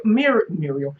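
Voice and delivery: normal talking voice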